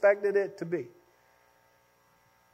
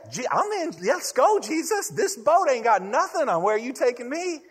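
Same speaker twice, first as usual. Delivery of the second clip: higher, hyped-up "let's go" voice; eager Peter; 6 semitones higher than usual. Retold or imitated. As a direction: play Peter raring to set off. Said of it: "it was gonna be like".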